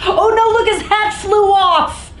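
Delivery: high, loud oh no; loud excited exclamation; excited alarm. Real, not acted. Exclaims at picture.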